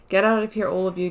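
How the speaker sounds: usual speaking voice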